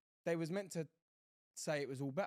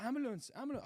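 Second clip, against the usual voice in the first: mock scream; 11 semitones higher than usual. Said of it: "like, screaming".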